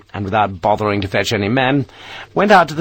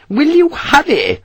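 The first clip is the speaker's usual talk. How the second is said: very high, loud nagging; shrill-pitched impatient matron; 5 dB louder than usual. Acted. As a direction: demand haste, exasperated. Mrs Parker impatient again.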